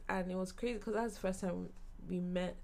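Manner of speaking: ordinary delivery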